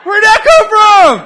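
loud, high, drawn-out disbelief; mock shock. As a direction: cry out in comic amazement. Mock shock at friend's laugh.

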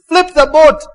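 high, loud call to the guide; eager shouted order. Retold or imitated. Wife cutting in to the raft guide, shouted order. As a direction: cut in with a shout. Wife cuts in, acted quote.

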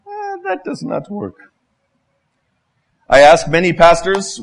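ordinary talk

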